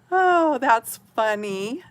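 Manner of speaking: ordinary delivery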